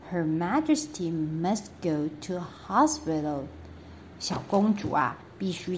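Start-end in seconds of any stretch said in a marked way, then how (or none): none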